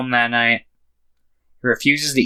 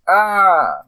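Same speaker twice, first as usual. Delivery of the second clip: high, drawn-out mock scream; 8 semitones higher than usual. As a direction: imitate a frightened kid's cry. Imitating kid's scream at body.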